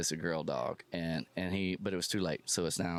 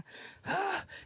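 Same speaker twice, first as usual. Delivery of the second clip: dramatic sigh. Retold or imitated. Losing favorite shoes.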